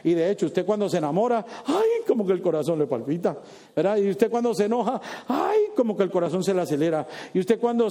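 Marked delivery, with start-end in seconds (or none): 1.7-2.0 ay. Acted. Heart skips when in love. 5.3-5.6 ay. Acted. Heart races when angry.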